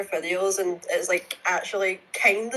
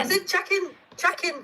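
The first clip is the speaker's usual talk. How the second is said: chicken with a Scottish lowered first vowel, near "chucken"; high-pitched Scottish chicken, insisting; Scottish accent; 10 semitones higher than usual. Real, not acted. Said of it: "you're saying chucking".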